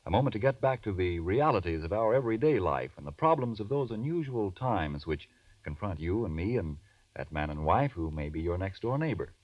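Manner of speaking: ordinary talk, nothing marked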